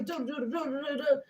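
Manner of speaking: mock aggressive jabbering. Summing up the pushy caller, mock jabbering noises. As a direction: mimic his onslaught in nonsense syllables. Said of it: "He's already loud. He's already aggressive".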